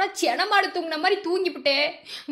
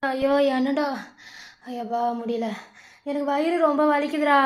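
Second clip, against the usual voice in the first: pained moan; 3 semitones lower than usual. Acted. Sick mother moaning with stomach ache.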